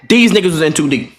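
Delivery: ordinary delivery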